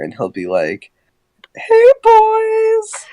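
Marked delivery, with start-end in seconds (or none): 1.5-3.1 very high falsetto, loud; mock girly greeting in a squeaky falsetto; Lenny Loud. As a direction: imitate a man's fake high-pitched voice.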